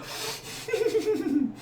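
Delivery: high, quiet quivering giggle; quivering orc laugh. Retold or imitated. Orc facing the player, high quivering creepy laugh. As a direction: shiver the laugh out, unsettling. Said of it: "disturbing, quivering laugh".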